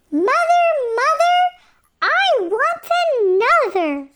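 high, loud wail; a child crying out in protest; wailing baby elf. A narrator's reading. Baby elf wailing at Missus Frog, high and loud.

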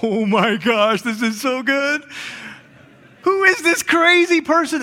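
rising, wide-eyed mock amazement; excited audience member. Audience member reacting to the wild man, lightly excited. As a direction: gasp it like a thrilled theatergoer. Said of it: "And you're like".

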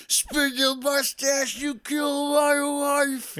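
high, slow, loud cartoon villain shout. Mocking Ope's order to Chips, high slow cartoon shout. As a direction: belt it out like a hammy villain.

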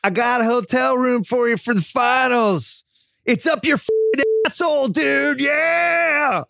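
bad Texas accent. Mock Texas accent taunting Russillo with a hotel room. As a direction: hammer the bad Texas voice, dude and yeah. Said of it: "the bad Texas accent".

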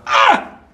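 short, high, loud "ah!" of frustration; exasperated yell. Retold or imitated. Reservist who should feel grateful but is fed up, exasperated yell. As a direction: burst out in a mock exasperated scream. Acts out his exasperation mid-exercise.

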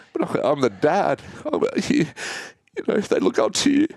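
fighting back tears as he says it; voice breaking. Real, not acted. Dad about to tell his kids, voice breaking.

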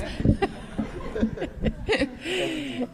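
a short real burst of laughter. Real, not acted. Senator laughing at having had time to think, real laugh. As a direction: laugh it off with relief. Nervous laugh after answering.

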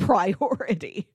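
ordinary delivery

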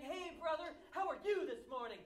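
high, loud, chirpy churchgoer hello; gushing fake-bright hello at church; mock overexcited greeting. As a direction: ham up a too-cheerful greeting. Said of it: "they're so excited about everything".

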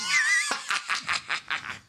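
very high, shrieking evil laugh; over-the-top supervillain laughter; villain cackle. Acted. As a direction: cap the vow with a maniacal cackle. Supervillain monologue ends in cackle.

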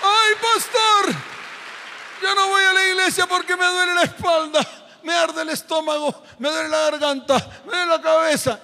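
mock a lazy churchgoer's excuses; whiny excuse-maker. (burla quejumbrosa del que no viene)

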